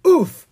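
short, high, loud oof; winded grunt under a weight; coughed oof. A narrator's reading. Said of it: "Owen coughed".

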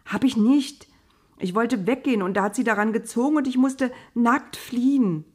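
indignant protest. A narrator's reading. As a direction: blurt out your defence in agitation. Accused youth protests his innocence. (aufgebracht protestierend)

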